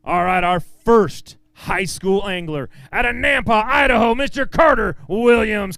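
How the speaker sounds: big drawn-out ring announcer call; announcer intro. Acted. Emcee introducing the next pro to the crowd, booming announcer style. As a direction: sell the name like a boxing intro.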